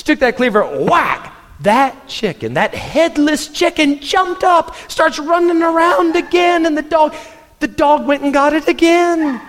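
high, fast-rising excited telling; excited storytelling. Real, not acted. Preacher telling the church about the headless chicken, excited. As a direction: tell it in wide-eyed disbelief.

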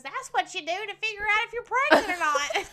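high, loud retort breaking into laughter; imitated little brother. Retelling little brother's answer to co-host, loud imitation. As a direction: blurt it like a know-it-all kid, laughing. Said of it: "little brother goes".

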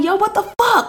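very high-pitched comic outrage; retold snapping. Hopping out at the driver behind, retold snapping. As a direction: confront the other driver in a squeaky, indignant replay. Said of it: "like, snapping".

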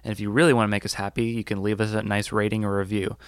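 upbeat announcer read; bright sign-off to listeners; podcast outro. Acted.

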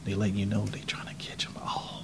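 conspiratorial whisper. Pretend. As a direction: hush it like a secret. Conspiracy talk, hushed.

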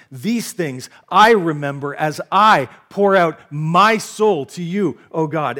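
slow, loud reading, punching "my" and "I"; emphatic psalm quotation. Acted. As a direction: drive home each "my" and "I", drawn out. Quotes psalmist's self-references to show raw emotion.

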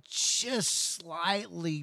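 JUST SLIGHTLY stretched out slowly; drawn-out emphasis. Real, not acted. Explaining a tiny skillet burn to the listener, JUST SLIGHTLY drawn out. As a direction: draw out "just slightly" at half speed. Stresses how small the burn is.

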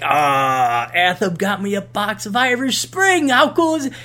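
drawn-out "ah", then climbing pitch; playfully thrilled, pitch building to the end; mock delight. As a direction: act out gleeful discovery, rising to a high finish. His delight at finding the soap.

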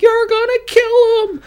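very high, loud falsetto wail; imitated panicked plea. Imitating the friend on the cliff as he squeezes, panicked wail. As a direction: wail it in a mock-desperate falsetto.

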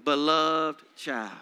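slow, deliberate confession, word by word; slow affirmation. Shown as a demonstration. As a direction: let each word land slowly. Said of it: "Write it to yourself".

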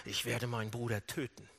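low, hushed threat; restrained fury. Retold or imitated. Esau furious at his little brother, quietly menacing. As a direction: drop low and soft, vowing revenge. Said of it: "Esau ist so wütend".

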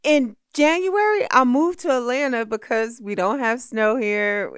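hammed-up indignant protest; mock outraged refusal. Guest retelling her refusal to move, loud and outraged.

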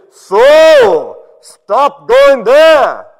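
high, thunderous full-volume command; huge drawn-out shout; booming voice. Acted. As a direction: bellow it slowly like God from heaven. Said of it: "I heard this booming voice".

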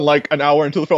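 ordinary talk, nothing marked

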